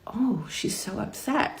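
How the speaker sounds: very soft, slow, sympathetic inner thought; softly pained aside; hushed realization. Retold or imitated. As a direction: drop to a near murmur, drawing it out. Hushed thought about upset boss.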